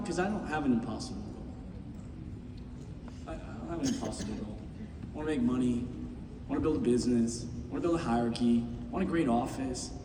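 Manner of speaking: owning his lack of goals, low and hushed; hushed confession. Real, not acted. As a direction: confess it quietly. Quiet confession to the crowd.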